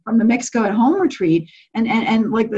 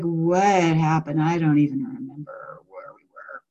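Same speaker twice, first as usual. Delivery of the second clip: low, slow and soft, lost and bewildered; a mild imitation of someone dazed and disoriented; dazed confusion; 6 semitones lower, 5 dB quieter, slower than usual. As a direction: say it like you just woke up not knowing where you are. Acting out a dazed post-retreat participant.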